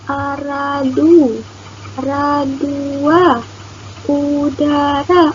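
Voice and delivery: drawn-out trial words; slow puzzling out. Shown as a demonstration. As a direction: try each order slowly. Teacher trying letter orders.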